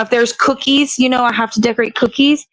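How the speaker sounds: ordinary delivery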